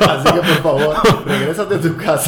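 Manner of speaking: loud, laughing note reading; loud mock reading.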